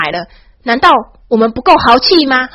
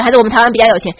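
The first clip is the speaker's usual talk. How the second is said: usual speaking voice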